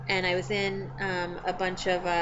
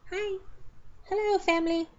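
high-pitched voice put on for a pet; pretend cat voice; 10 semitones higher, 3 dB quieter than usual. Cat greeting the family on camera, high put-on voice. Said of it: "gonna say hi".

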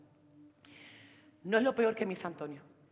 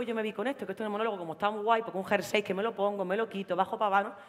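normal talking voice

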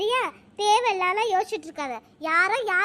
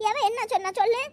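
ordinary delivery

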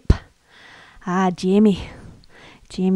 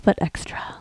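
unvoiced whisper; 5 dB quieter than usual. Sharing her mantra with viewers, whispered. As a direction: tease the punchline in a whisper.

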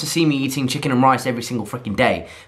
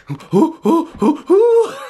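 rising, ever higher "oh"s of a dopey dog; 13 semitones higher, 4 dB louder, slower than usual. Retold or imitated. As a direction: voice a clueless dog getting more and more worked up. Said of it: "And Jessie's like".

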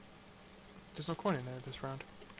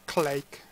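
phone hang-up noise; 10 dB louder than usual. Acted. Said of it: "The phone, it goes clake".

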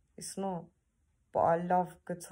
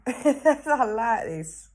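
laughed through, slow and louder; laughing; 5 semitones higher, 8 dB louder, much slower than usual. Real, not acted. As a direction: let the laugh carry the words slowly. Laughing, then repeats it plainly.

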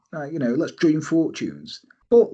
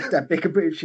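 usual speaking voice

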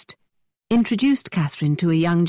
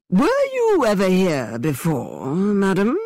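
slow, theatrical, overly polite gentleman; hammy stage actor; 3 semitones higher, slower than usual. A narrator's reading. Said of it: "continuing with his actor's voice".

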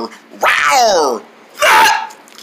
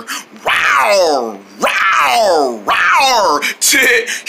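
tiger imitation; much slower than usual. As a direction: roar like a pretend tiger, building. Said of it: "he's pretending to be a tiger".

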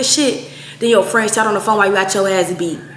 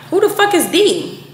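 confused; 9 semitones higher than usual. Retold or imitated. Confused retold reply.

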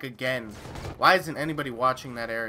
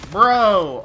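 alarmed shout; 6 semitones higher, 11 dB louder than usual. Real, not acted. Sudden alarm at a teammate.

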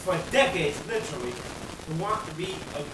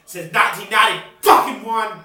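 furious cursing; 10 dB louder than usual. Real, not acted. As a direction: roar the curses out. Final furious curses.

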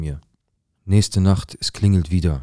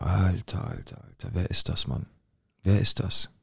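soft, half-voiced disbelief; stunned inner thought; 6 dB quieter than usual. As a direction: let it sink almost to a whisper. Eigene ratlose Gedanken nacherzählt. (fassungslos, fast geflüstert)

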